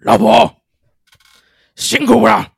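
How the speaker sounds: lightly voiced bold husband; loud, hearty husband line. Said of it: "我觉得应该要这样子".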